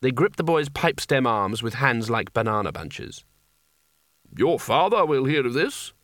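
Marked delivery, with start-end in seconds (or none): 4.4-5.9 put-on a stern wizard rebuking the boy; lightly voiced severe reproof. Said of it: "said Spelter severely".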